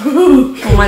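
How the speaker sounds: normal talking voice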